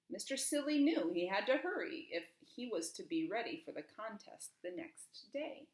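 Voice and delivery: plain reading voice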